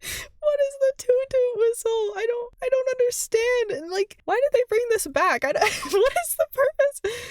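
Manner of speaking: can't believe it, disbelief; baffled. Real, not acted. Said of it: "incredulous rant".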